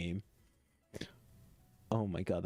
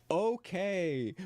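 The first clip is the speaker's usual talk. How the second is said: high, loud drawn okay; overwhelmed okay; 9 semitones higher, 5 dB louder than usual. Real, not acted. Streamer reacting to dark backstory, high and overwhelmed. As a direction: squeak it out, overwhelmed.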